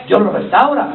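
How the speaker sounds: ordinary talk, nothing marked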